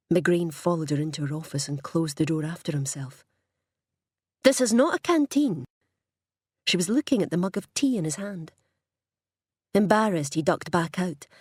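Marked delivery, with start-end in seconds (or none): 4.4-5.6 sharp, higher and louder rebuke; stern reprimand. Acted. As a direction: tell off a subordinate. Boss scolds subordinate over tea.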